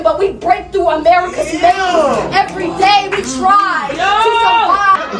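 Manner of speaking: loud, high, driving slam poetry; slam poetry performance. Acted. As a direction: perform it to the whole room. Second slam round performance.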